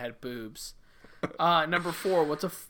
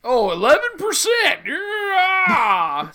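mock excited shout; 12 semitones higher, 8 dB louder, much slower than usual. Mocking the beer percentage.

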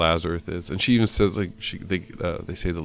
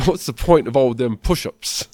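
higher, louder mock Cockney; Michael Caine impression; 6 semitones higher, 10 dB louder than usual. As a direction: exaggerate the Cockney, pitched up and loud. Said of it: "That's my Michael Caine".

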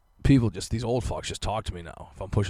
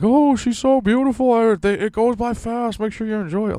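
put-on old lady voice; 11 dB louder than usual. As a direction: ham up a gushing granny cooing over the baby.

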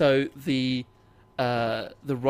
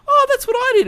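much higher and louder, delighted; excited recognition; 11 dB louder, faster than usual. Retold or imitated. Recalling school days to Steve, in a high excited squeal. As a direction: squeal it like a happy memory just came back. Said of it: "my first instinct would be like".

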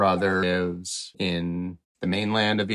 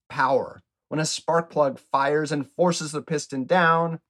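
bright, higher storytelling read; explainer read; 4 semitones higher than usual. Acted. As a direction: explain it like a kids' show host. Host reads the explainer.

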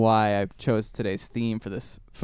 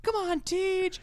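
much higher, shrill-pitched cheering mom call; cheering sports mom; 3 dB quieter, slower than usual. Retold or imitated. Mom in the stands cheering her son, much higher. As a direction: shout encouragement from the stands. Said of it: "his mom was always in the stands going like".

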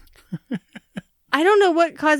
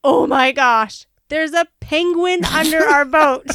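drawn-out, louder cry of disbelief; retold yelling; 3 semitones higher, 5 dB louder, slower than usual. Wife telling husband what is under the boat, retold yelling. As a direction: yell it slowly in mock astonishment. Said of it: "I mean, I'm yelling".

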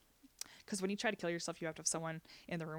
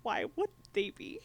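"Why would they be?" echoed much higher, disbelieving; incredulous echo; 8 semitones higher, 3 dB louder than usual. Retold or imitated. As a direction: repeat it back in shocked disbelief. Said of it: "And she was like".

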